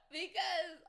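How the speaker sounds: high, loud squeal after cackling; laugh-strained. Real, not acted. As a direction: force it out on the tail of laughter. Recovering from a cackling fit.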